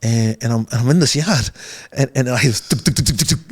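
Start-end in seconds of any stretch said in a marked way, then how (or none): 2.7-3.4 rapid tuk-tuk sound effect; imitated noise. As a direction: make a quick string of tuks.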